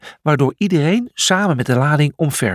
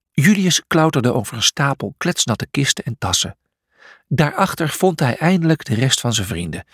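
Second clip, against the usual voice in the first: ordinary delivery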